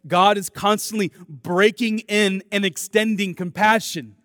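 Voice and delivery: loud, rising pulpit preaching; preaching at full force. Acted. Pastor preaching to the church, loud and urgent. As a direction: drive the gospel home to the congregation.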